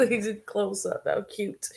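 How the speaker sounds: ordinary talk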